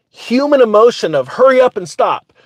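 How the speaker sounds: usual speaking voice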